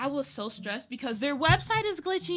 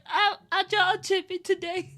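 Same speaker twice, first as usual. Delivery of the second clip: louder, higher, giggling answer; laughing reply; 6 semitones higher, 7 dB louder than usual. Retold or imitated.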